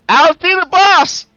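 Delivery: slow, high-pitched shout; high loud yell. Acted. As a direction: holler it out at full voice. Character peeks, then yells out.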